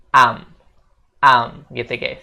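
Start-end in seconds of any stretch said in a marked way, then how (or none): 0.1-0.5 "am" said as one short contracted sound; contracted I'm. Shown as a demonstration. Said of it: "usahakan untuk bilang". 1.2-1.6 "am" said as one short contracted sound; contracted I'm. Shown as a demonstration. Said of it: "usahakan untuk bilang".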